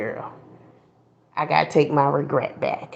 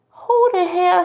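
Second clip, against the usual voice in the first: indignant; 12 semitones higher than usual. Retold or imitated. Retelling her own ego reaction.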